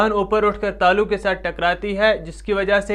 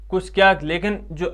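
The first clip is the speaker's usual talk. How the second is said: ordinary talk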